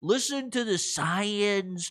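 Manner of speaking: hint of a fussy official; mild imitation of the doctor; mock Fauci. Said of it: "he goes".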